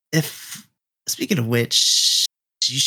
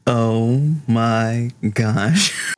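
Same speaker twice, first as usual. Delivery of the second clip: slow, stretched-out oh my gosh with a rising gosh; drawn-out oh my gosh; much slower than usual. Real, not acted. Player to the table, slow drawn-out amazement. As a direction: let it hang, slowly amazed. Reaction to wrestling reference.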